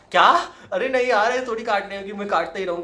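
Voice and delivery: high, loud alarmed protest; loud distressed cry. Real, not acted. Distress at cutting.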